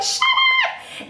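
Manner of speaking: very high squealed shout of delight; excited shriek. Retold or imitated. Retelling her reaction to the new highlighter, shrieking with delight. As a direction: shriek it like you can't believe it. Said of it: "I literally screamed".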